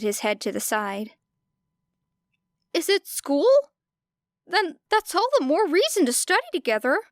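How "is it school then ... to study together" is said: put-on higher, upbeat boy; bright. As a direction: cheerfully push for studying together. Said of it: "he said brightly".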